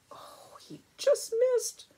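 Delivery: high, slow "oh, he just missed"; relieved surprise, voice jumping up; surprised gasp. Real, not acted. Reader reacts to near catch.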